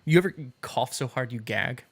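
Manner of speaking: ordinary talk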